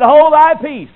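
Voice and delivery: loud, high, stern command to a demon; commanding rebuke. Acted. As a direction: bark the order with full authority. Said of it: "Jesus said".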